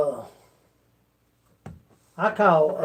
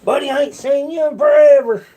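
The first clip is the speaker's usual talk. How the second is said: higher, louder hammed-up hello; mock loud greeting; 8 semitones higher, 5 dB louder than usual. Acting out a stranger greeting him loudly, mock shouting. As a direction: boom it out like an overeager old buddy. Acted greeting from forgotten acquaintance.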